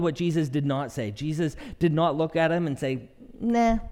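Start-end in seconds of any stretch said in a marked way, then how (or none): 3.4-3.8 high, offhand mock "nah"; lightly dismissive refusal; mock dismissal. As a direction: casually brush them off, pretend. Mock refusal put in Jesus' mouth.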